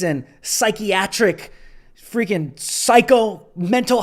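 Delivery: loud, high, indignant tirade; impassioned rant. Real, not acted. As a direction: hammer it out with righteous anger. Impassioned rant against accused racists.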